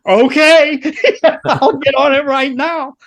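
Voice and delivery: high, loud, laughing eagerness; retold giddy happiness. Relieved tech accepting the next guitar job, giddy and high. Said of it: "so happy".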